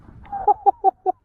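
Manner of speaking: high, loud happy laugh. Real, not acted. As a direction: laugh out with pride. Laugh after measuring fish.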